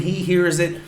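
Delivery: usual speaking voice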